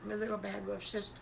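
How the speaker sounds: ordinary delivery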